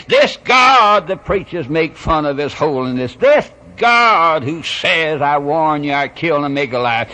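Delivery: loud, high bursts on each opening phrase; full-force preaching. Real, not acted. Preacher declaring what this God did, full-force preaching. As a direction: thunder it from the pulpit.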